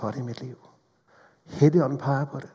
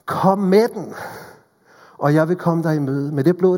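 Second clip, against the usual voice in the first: loud, raised preaching appeal; full-force preaching; 4 semitones higher, 6 dB louder than usual. Acted. Preacher urging listeners to come to Jesus, in full-force preaching. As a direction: call them forward with fervour.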